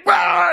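high, loud imitation of a furious outburst; mock enraged yell. Imitating a man losing it when laughed at, mock enraged yell. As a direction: burst out in a mock angry "Ah!". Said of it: "he, like, doubles down, gets even more mad".

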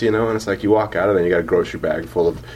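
usual speaking voice

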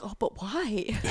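lightly voiced toddler asking why; a small child's puzzled why. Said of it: "And she's like".

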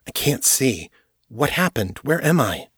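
alarmed questions. Acted. Jared waking blind in the cell, mildly alarmed. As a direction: ask it rattled and confused in the dark.

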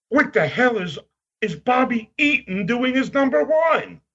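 high-pitched mock outrage in his voice; Lord Alfred Hayes. Wrestler reading the newspaper ratings to a fan, imitated as incredulous. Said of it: "And he goes".

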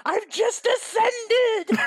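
slow, high, awestruck; mock awe. Real, not acted. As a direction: stretch it out in mock wonder. Mock awe at absurd history.

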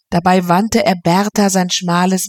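ordinary delivery, nothing marked

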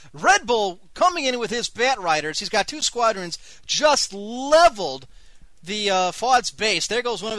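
big live-match call; excited play-by-play. Acted. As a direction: call the attack like a sportscaster, stretching the key word.

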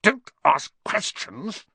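high, slow, emphatic order; irritable command; snapped. A narrator's reading. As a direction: snap it word by word. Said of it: "snapped his uncle".

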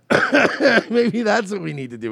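high, loud laughing agreement. Real, not acted. Laughing at own joke.

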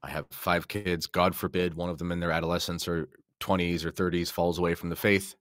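ordinary talk, nothing marked

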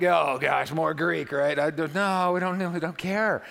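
exaggerated whiny push-back; mock objecting congregation. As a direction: complain loudly like a reluctant student. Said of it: "it's like".

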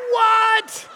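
very high, drawn-out cry; mock shock. Passenger who just got hit, comically shocked. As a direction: exclaim in exaggerated disbelief.

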